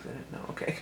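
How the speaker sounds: ordinary delivery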